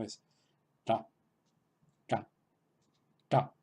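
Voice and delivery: "ta" said with the tongue tip curled back; retroflex ta. Shown as a demonstration. Teacher modelling the Sanskrit retroflex "ta" for students. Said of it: "simplemente imitando la pronunciación".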